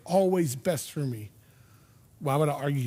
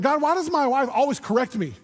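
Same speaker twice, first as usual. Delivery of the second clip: higher-pitched mock complaint to God; complaining; 6 semitones higher than usual. Husband griping to God about his wife, higher mock complaint. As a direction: grumble in prayer like a put-upon husband. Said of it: "because now he's complaining".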